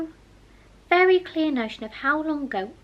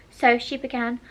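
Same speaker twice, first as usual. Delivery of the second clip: ordinary delivery, nothing marked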